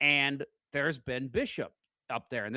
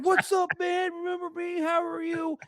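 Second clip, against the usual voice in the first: put-on drunk greeting. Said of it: "And I'm just like".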